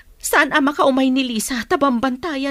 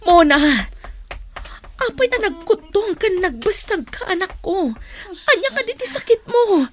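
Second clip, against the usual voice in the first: alarmed calling; 7 semitones higher than usual. Acted. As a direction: rush to her with worry. Mother sees sick daughter arrive.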